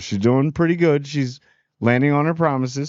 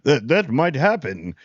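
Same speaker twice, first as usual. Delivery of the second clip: smug councillor; 3 semitones lower, slower than usual. Retold or imitated. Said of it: "he laying it on Rhaenyra like".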